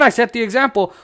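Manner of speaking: normal talking voice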